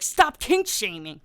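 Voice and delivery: loud, drawn-out mocking quote; exaggerated mock complaint; mock protest. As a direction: mimic the scolders, slow and loud. Mocking what people say back.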